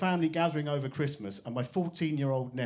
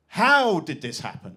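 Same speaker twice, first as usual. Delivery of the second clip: put-on indignant teen demand; 7 dB louder than usual. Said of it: "she turned around and went".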